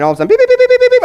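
high loud mock beeping alarm; sharp high electronic beeps; car beep imitation. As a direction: squeal like a lane warning beeper.

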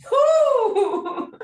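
high laughing whoop; excited whoop. Real, not acted. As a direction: cheer with a laugh. Cheering the joke.

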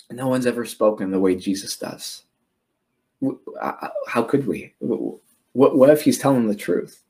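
low, faltering reply acted out; imitating awed guards at a loss; hesitant guards. Guards answer the Pharisees, at a loss.

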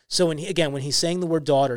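usual speaking voice

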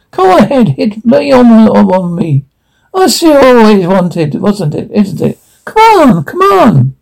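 a mocking, overdone Irish lilt, pitched high; bad Irish accent. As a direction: goad him in a hammed-up Irish brogue. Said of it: "in a dreadful, cold, old Irish accent".

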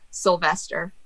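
usual speaking voice

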